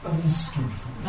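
low, soft, pausing plea; tender intercession. Acted. Advocate speaking to the Father for Curry, tender.

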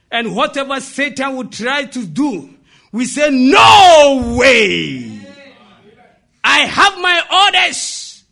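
defiant shout with long-drawn words; preaching. Real, not acted. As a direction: roar your refusal to the congregation.

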